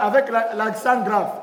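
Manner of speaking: usual speaking voice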